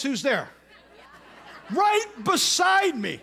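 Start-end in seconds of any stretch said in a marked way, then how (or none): 1.7-3.2 slow, loud and high, disbelieving; incredulous shout. Retold or imitated. Preacher telling the congregation who sat beside him, shouting in disbelief. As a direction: spell it out in shocked disbelief.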